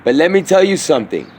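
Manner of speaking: usual speaking voice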